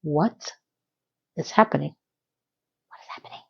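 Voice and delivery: hushed, trailing off to a whisper; inner thought. Retold or imitated. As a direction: mutter it to yourself in disbelief. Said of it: "I'm thinking to myself".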